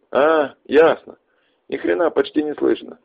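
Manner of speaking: shouting over wind. Acted.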